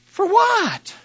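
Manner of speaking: high, loud, exaggerated mock bewilderment; baffled wife. Wife answering husband's unexpected apology, acted loud high bafflement. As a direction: squawk it in a put-on high voice. Voicing wife's startled reply to apology.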